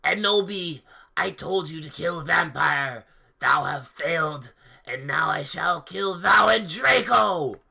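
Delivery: loud hammed-up dark lord threat; mock villain shouting his threat. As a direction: play the evil wizard, bellowing. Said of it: "he shouted angstily".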